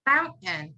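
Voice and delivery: "fountain" as foun, catch, "en"; silent T. Shown as a demonstration. Teacher models it back to student, silent T. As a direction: swallow the T in "fountain". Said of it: "completely gone and it's replaced with an N".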